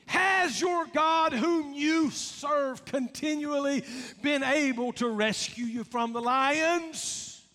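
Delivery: anguished king calling to his servant. Acted. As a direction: plead into the den, desperate. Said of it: "in an anguished voice".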